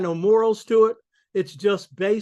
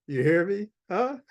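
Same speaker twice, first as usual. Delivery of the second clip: normal talking voice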